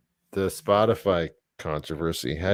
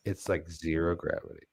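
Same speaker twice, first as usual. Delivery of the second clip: hushed demo read; 10 dB quieter than usual. Shown as a demonstration. Host showing co-host another way to say it, hushed. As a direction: drop the voice right down, almost to yourself. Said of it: "Now say it like this".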